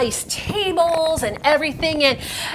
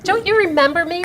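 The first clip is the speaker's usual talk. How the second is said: ordinary talk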